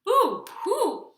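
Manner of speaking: high, loud hooted cry; frightened owl. Acted. Owl seeing the Gruffalo, high loud frightened hoot.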